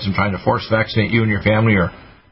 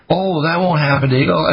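imitated doubter; 5 semitones higher than usual. Doubter waving off the doctor's warning, mock dismissive. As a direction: mimic a skeptic brushing him off.

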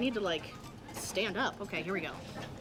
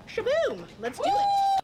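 excited; 5 dB louder, much slower than usual. Real, not acted. Starting the eating challenge.

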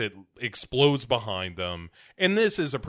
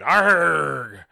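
loud, high mock roar of rage; hammed-up furious growl, louder and higher; angry pirate growl; 6 semitones higher, 7 dB louder than usual. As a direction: bellow it at the sky. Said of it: "the most ridiculous growl of anger".

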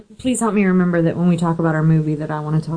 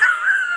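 short laugh. Real, not acted. Laugh after joke remark.

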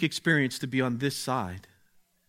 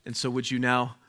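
prayer. Acted. Leads a prayer.

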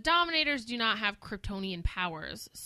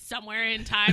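ordinary talk, nothing marked

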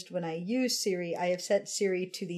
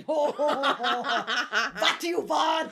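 put-on German accent, pitched up; German Siri; 3 semitones higher than usual. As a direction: play a voice assistant with a fake German accent. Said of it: "think German would be fun".

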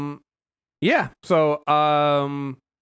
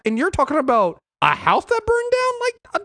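incredulous rant; 8 semitones higher than usual. Retold or imitated. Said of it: "I'm like".

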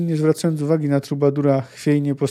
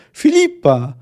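raised, louder cry of alarm; shouted; 6 semitones higher, 6 dB louder, slower than usual. A narrator's reading. Jaskier shouts at the sorceress to stop her, shouted. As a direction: call her name out sharply, pitched up. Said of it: "krzyknął Jaskier".

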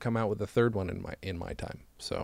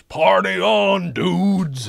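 big, booming stage proclamation; Abraham Lincoln; 8 semitones higher, 8 dB louder, much slower than usual. Acted. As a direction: holler it slowly as a grand catchphrase. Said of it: "I also play Abraham Lincoln".

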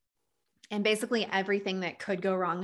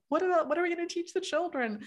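mock worried parent; 10 semitones higher, faster than usual. Voicing a worried parent question.

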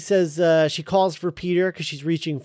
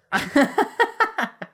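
high, bubbling laugh; amused laughter. Real, not acted. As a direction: burst out laughing at the joke. Laughing at the cave joke.

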